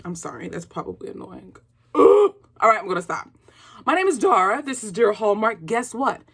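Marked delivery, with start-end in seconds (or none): none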